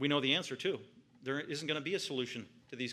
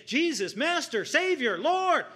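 each name higher and louder, building to a cry; desperate calling; 12 semitones higher, 8 dB louder, slower than usual. Retold or imitated. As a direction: call out in rising desperation. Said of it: "they repeatedly say".

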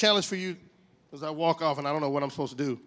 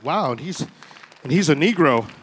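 mock astonishment; 3 semitones lower, 12 dB louder than usual. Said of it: "And everybody was like".

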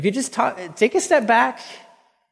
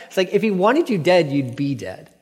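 ordinary talk

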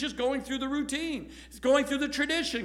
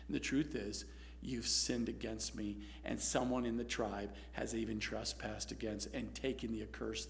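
much softer, lower, hushed divine voice; quiet voice of God; 11 semitones lower, 13 dB quieter than usual. Acted. God to Joshua after his outburst, hushed and firm. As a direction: speak low and close, calm authority.

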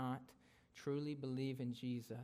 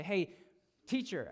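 demanding man; 6 semitones higher, 6 dB louder than usual. Retold or imitated. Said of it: "They say".

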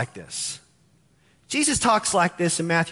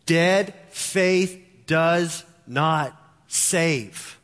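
very slow emphasis; much slower than usual. Acted. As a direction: drag out each word of dead faith does not save. Preacher repeats key phrase.

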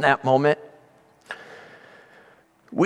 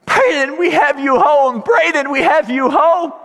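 put-on high, overwrought cry to a child; retold tearful reunion, pitched high; crying out in relief; 13 semitones higher, 4 dB louder than usual. Parents holding their found son, crying out in relief.

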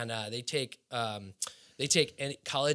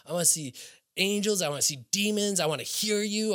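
higher, pleading call upward; calling out to God; 6 semitones higher than usual. Retold or imitated. Retelling how he called out to God, in a raised pleading voice. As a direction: cry out to the Lord, reaching. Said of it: "calling out".

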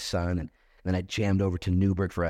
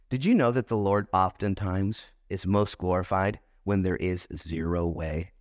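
usual speaking voice

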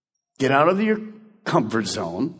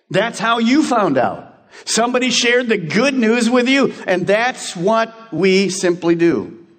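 higher, slow sermon emphasis; preaching; 7 semitones higher, slower than usual. Acted. Pastor reminds the congregation someone shared with them, preaching slowly. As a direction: press it home slowly.

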